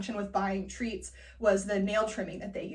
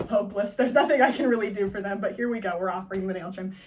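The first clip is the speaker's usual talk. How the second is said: ordinary delivery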